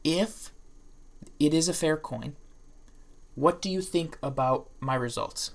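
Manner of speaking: slow, weighty, deliberate delivery; slow and meaningful. Shown as a demonstration. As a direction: lean on each phrase as if it matters. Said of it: "slow and meaningful when I say this".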